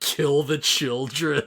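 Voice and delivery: slow, hammed-up villain order; theatrical evil order, breaking into a laugh; Leomon impression. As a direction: drag out the command with mock menace. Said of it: "he's just like".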